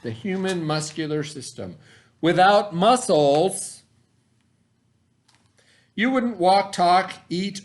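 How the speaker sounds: put-on clear, deliberate read-aloud voice for kids; reading to children. Teacher reads the science book to her students, storytime voice. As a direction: read the book slowly to the class.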